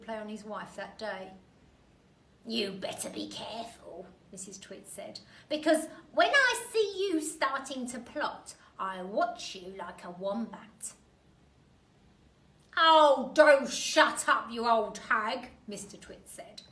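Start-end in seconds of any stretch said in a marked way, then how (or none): 2.5-4.1 a lightly voiced nasty old wife, warning; Mrs Twit voice. Missus Twit warning husband. 5.5-10.9 put-on nasty old wife, louder and menacing; Mrs Twit voice. Missus Twit warning husband. 12.8-15.5 put-on loud, slow grumpy old man; Mr Twit voice. Mister Twit snapping at wife.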